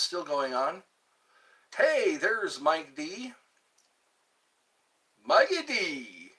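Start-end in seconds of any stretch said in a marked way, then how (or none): 5.2-6.3 calling out. Real, not acted. Calling a friend.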